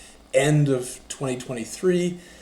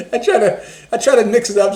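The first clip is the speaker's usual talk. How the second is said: laughing; 9 semitones higher, 9 dB louder, faster than usual. Real, not acted. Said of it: "laughing after demo".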